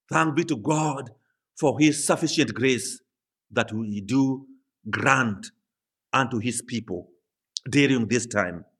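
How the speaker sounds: ordinary talk, nothing marked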